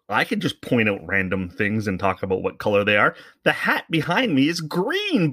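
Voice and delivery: put-on high, sing-song kids' host voice; mocking Blippi voice. Podcaster mocking a kids' YouTuber, sing-song. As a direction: mimic a goofy children's presenter. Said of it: "you watch Blippi and you think".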